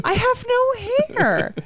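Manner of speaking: high, slow keening wail; mournful keening wail. Pretend. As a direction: stretch it out like a wailing banshee. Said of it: "sounds like a hairless banshee".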